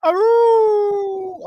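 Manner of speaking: high loud wolf howl. Pretend. Wolf howl watching Wolf show.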